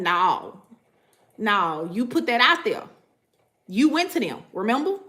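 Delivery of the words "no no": ordinary talk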